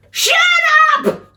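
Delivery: short, loud yell; angry scream. Acted. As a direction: scream it from next door. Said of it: "screamed the neighbours".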